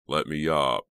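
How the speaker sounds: plain reading voice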